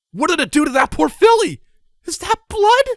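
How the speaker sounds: shrill high horrified shout; panicked shouting. Acted. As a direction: shriek at the sight of blood. Ponies shrieking in terror.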